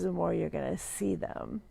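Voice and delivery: ordinary talk, nothing marked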